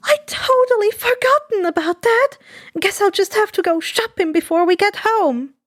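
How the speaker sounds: bright realisation tumbling out; flustered surprise. Acted. Inko realising her groceries are gone, flustered.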